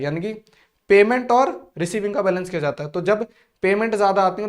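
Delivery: usual speaking voice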